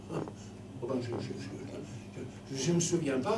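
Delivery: soft, slow hesitant stutter; stammering soul. Acted. Soul stammering before Saint Peter, soft hesitation. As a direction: fumble for an excuse.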